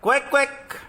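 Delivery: short quack quack; duck quacks. Shown as a demonstration. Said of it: "Now it comes to duck".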